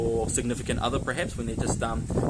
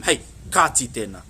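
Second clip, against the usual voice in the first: louder, lower, firm warning; parental telling-off; 4 semitones lower, 5 dB louder than usual. Acted. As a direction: rein in a child who went too far. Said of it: "they just push it a little bit".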